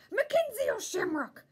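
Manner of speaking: loud, high angry yell of his name. A narrator's reading. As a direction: yell your own name in a temper. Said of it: "I yelled".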